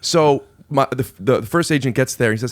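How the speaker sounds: ordinary talk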